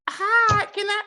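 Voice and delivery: put-on high, sugary little-girl voice; fake kid voice. Imitating the girl's babyish greeting to cohost. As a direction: squeak it like a child actor. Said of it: "But the voice was too much".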